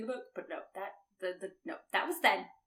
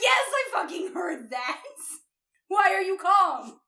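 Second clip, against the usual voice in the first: exasperated yell; 6 semitones higher, 7 dB louder than usual. Retold or imitated. Jensen yelling at calm Mustang, exasperated. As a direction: shout it in disbelief. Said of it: "Jensen's like".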